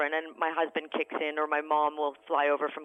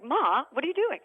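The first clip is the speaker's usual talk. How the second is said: high-pitched mock teen protest; embarrassed teenager; 9 semitones higher than usual. Mum voicing her embarrassed teenager at the school gates, mock protest. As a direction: imitate a mortified kid, pitched well up.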